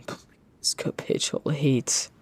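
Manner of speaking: slow breathy whisper; hushed slow begging whisper; whispered plea. Real, not acted. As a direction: beg under the breath, drawn out. Begging the game AI again.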